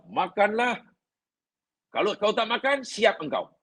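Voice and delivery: a hint of a menacing command, eat or else; half-joking warning; mock threatening. Shown as a demonstration. Teacher shows the harsh wording the Quran does not use, lightly menacing. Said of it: "Tak ada".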